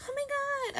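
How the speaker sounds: very high mock squeal; swooning fangirl. Any other girl hearing his smooth line, swooning. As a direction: imitate a girl gushing over a line.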